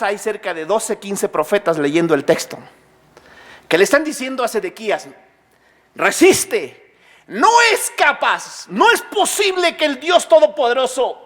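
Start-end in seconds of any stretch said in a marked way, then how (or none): 5.9-11.2 higher-pitched, emphatic cry of defiance; rallying exhortation, high and insistent; impassioned false prophets. Acted. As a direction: urge the king on with fervor. False prophets urging the king to resist.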